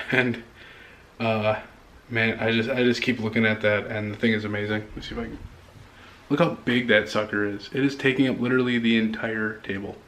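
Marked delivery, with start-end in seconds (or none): none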